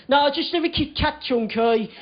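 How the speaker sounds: imitated Birmingham flatmate; Birmingham accent. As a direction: give in with broad Birmingham vowels. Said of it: "in his Birmingham accent".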